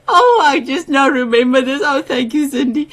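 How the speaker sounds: laughing, almost crying; words spilling out in a laugh. Real, not acted. Laughing at the memory.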